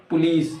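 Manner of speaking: "police" as puh-LEECE; corrected POLICE. Shown as a demonstration. Teacher correcting learners, "police" said as puh-LEECE. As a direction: say "police" with a long EE. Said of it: "it's not police".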